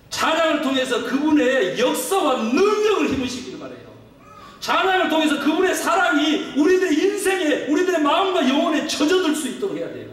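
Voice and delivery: high, driving pulpit exhortation; impassioned preaching. Real, not acted. Preaching at full force.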